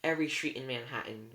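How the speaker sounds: usual speaking voice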